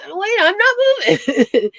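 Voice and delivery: high, loud mock protest; worked up. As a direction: play a panicked prank victim, half laughing. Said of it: "Get 'em all worked up".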